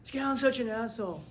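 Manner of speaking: resentful student. Retold or imitated. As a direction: grumble like a student denied grace.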